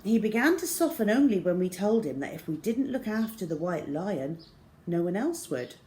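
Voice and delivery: usual speaking voice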